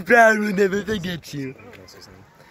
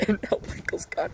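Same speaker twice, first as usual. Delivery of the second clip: whispered aside; 3 semitones higher, 11 dB quieter than usual. Acted. Mock sad aside about losing cohosts.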